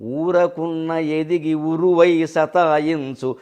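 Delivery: slow, drawn-out verse chanting; poem recitation. Acted. Preacher reciting Joshua's verse to the audience, slow and chanted. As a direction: chant it slowly, savouring each word.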